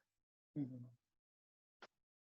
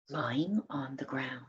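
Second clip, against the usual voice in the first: whisper; 4 semitones lower, 8 dB quieter than usual. A narrator's reading. Suspenseful discovery of egg.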